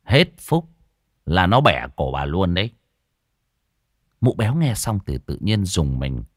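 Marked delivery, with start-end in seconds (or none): 0.0-2.7 put-on low, grim young man's warning; ominous warning. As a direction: warn her darkly.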